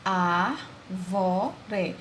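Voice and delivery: "A, vó, ré" as three separate beats; syllable by syllable. Shown as a demonstration. Teacher dividing a word into three syllables, syllable by syllable. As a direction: pause between each syllable of "A, vó, ré". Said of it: "é dividida em três sílabas".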